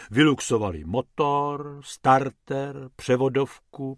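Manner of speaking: ordinary delivery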